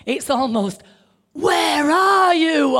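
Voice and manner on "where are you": slow, loud, drawn-out divine call; dramatic booming God. Shown as a demonstration. Preacher acts out God calling Adam in the garden, slow and booming. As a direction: boom it out slowly like a voice from heaven. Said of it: "sound effects on certain words".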